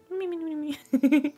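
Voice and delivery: high-pitched cutesy mi-mi snoozing; tiny sleeping snores. Retold or imitated. Streamer wanted to see the fireflies sleep, imitates high tiny snores. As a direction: squeak out little sleepy noises. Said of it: "Kunde vi inte få se när de sover?".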